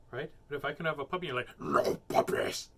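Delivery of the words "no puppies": slow, breathy put-on voice for another character; a clearly different voice for the parent. Shown as a demonstration. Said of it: "Then they know it's you".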